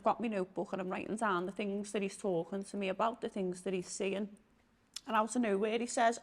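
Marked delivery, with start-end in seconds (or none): none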